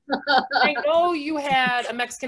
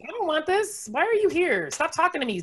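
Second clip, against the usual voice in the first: high-pitched irritated rant; comically fed-up, pitched up; mock angry; 6 semitones higher than usual. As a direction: fend off an unwanted chatty waitress. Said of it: "I'm very angry today".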